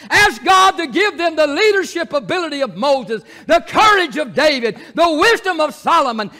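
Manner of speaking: loud, high, building preacher's cry; preaching at full force. Acted. Preacher calling the church to pray for change, full force.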